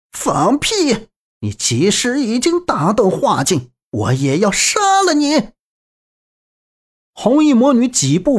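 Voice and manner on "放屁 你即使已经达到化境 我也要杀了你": high, furious villainess cry; hoarse roar. A narrator's reading. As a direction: scream your defiance at him. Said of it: "嘶吼道".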